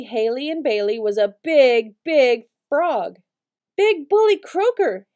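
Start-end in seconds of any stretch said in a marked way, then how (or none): 3.8-5.1 high frightened scream. Acted. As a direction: shriek the monster's name in alarm. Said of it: "Haley screamed".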